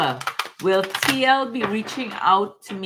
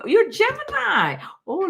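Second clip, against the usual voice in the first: excited. Real, not acted. Reader shares caller's star sign.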